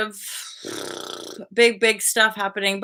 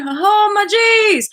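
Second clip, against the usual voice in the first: oh my jeez; 12 semitones higher, 7 dB louder than usual. Real, not acted. Delighted realisation while telling.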